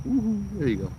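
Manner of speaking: high happy whoop for the dog; excited cheer. Real, not acted. Owner cheering his dog on with a high whoop.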